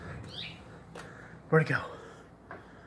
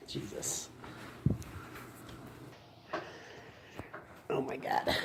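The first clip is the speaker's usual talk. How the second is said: whispering; 18 dB quieter than usual. Real, not acted. Hiding quietly from the bird.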